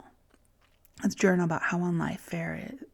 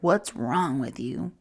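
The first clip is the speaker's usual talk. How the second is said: shaming voice; 3 semitones lower, slower than usual. Pretend. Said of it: "That is the voice".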